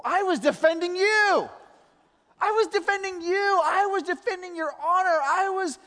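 high, loud indignant cry; outraged protest. Retold or imitated. Dad protesting to his seven-year-old, loud and high, indignant. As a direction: protest loudly that you were defending her.

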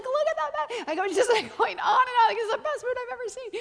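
high-pitched, breathless gushing; excited ranting. Retold or imitated. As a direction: rattle on excitedly, unable to stop. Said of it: "I'm ranting about it".

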